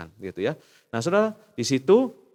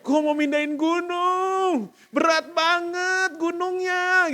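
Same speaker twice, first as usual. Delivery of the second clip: very high, very slow mock groan of effort; mocking strained whine; tired believer; 3 dB louder, much slower than usual. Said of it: "Saudara suka capek".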